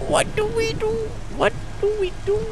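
shrill high-pitched panic; high panicked squeal. Real, not acted. Panic in game, monster chasing.

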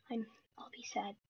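soft mock-sad whisper. Real, not acted. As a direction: whisper it to yourself. Aside while hiding.

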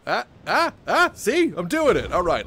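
real excitement, loud and high; thrilled outburst. Real, not acted. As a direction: yelp with delight as it works. Spell works, thrilled.

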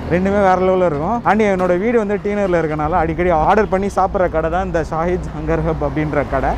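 ordinary talk, nothing marked

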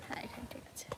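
hushed reply aside; whispering. Real, not acted. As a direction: whisper it quietly. Quiet aside during live stream.

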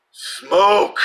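high, loud commanding devil's voice; the devil tempting a quitting smoker, forceful command. Retold or imitated. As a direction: bark it like a demon's order. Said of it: "forceful, commanding, powerful voice".

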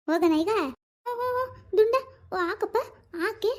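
high, slowing coo. Real, not acted. Soothing the fussing baby, cooing baby talk. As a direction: coo high and drawn out to an infant.

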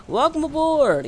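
hoch, laut und langsam, wie ein Busfahrer; begrüß die Fahrgäste feierlich gedehnt; Durchsage des Busfahrers. Pretend. Greeting passengers once more.

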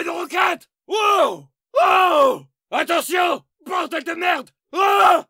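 yelling warnings as rockets land; panicked shouting. Acted. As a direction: scream run, take cover. Alerte explosifs et roquettes. (cris de panique)

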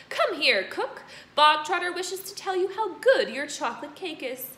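loud, high calling. Acted. Headmistress calling the cook, loud. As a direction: call her onto the platform.

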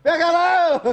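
high, laughing cheer; laughing shout. Real, not acted. Cheering the cut kite. (grito rindo)

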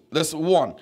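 usual speaking voice

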